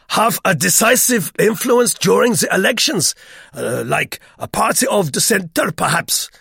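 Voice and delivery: put-on loud, high, emphatic French-Swiss accent; excitable Swiss man's clipped foreign accent. A narrator's reading. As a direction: pronounce weightily in a heavy French-speaking accent. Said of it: "in his clipped French".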